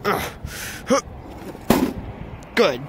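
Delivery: normal talking voice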